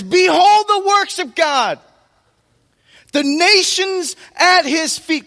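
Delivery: rally the army with it; battle shout. Retold or imitated. Israel's army shouting as they march into battle, loud and high. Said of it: "And they shouted".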